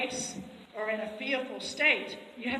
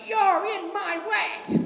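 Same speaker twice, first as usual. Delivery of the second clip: handler's voice; 9 semitones higher, 8 dB louder, much slower than usual. Retold or imitated. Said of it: "They're like".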